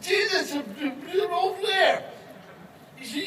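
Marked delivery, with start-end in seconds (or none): 0.0-2.0 much higher, drawn out slowly; excited pointing. Acted. Man pointing out Jesus excitedly.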